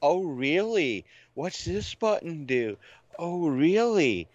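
high, slow, swooping flirty coo; mock feminine wiles. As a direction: play a hammed-up seductress. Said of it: "feminine wiles".

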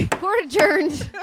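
slow, loud courtroom proclamation; hammed-up judge voice; mock judge. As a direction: bang the gavel and declare it adjourned. Mock courtroom closing the episode.

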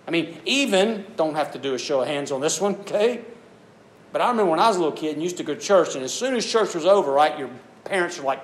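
usual speaking voice

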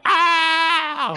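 high, loud crying wail; judges' wail. Retold or imitated. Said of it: "the judges would go".

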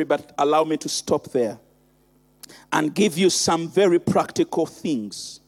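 intimate low sermon. Acted. Quiet intimate preaching stretch.

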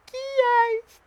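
tiny, very high and soft; a hidden little creature asks; a small voice from inside, high and soft. A narrator's reading. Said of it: "une petite voix".

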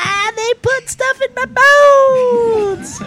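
a toy grinning through the pain; happy toy Wolverine voice. Pretend. Voicing the Weapon X toy.

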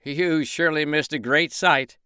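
high, puffed-up boast; boastful rooster. Acted. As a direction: show off to the pig.

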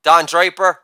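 normal talking voice

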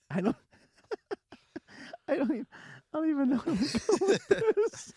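high-pitched laughing through words; can barely speak for laughing. Real, not acted. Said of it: "I can't stop laughing".

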